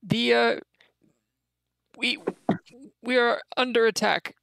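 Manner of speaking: high, halting goblin voice; nervous goblin messenger. Acted. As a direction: stammer the news up high like a scared goblin. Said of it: "spits out a tooth, says".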